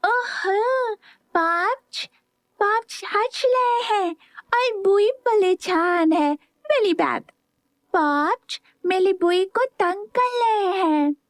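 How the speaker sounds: put-on high, slow toddler lisp; a little boy's high lisping voice. A narrator's reading. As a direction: babble like a small child. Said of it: "धीरे से बोला".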